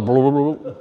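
low, quiet gurgling blah blah; mock belly rumble. Stomach rumbling at night after pie, mock gurgle. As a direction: make the belly grumble, low and soft.

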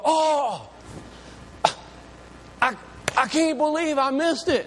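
high-pitched mock dismay, drawn-out oh; acted-out horrified realization; dismayed outcry. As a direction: wail it like you just missed out. Acts out discovering a missed event.

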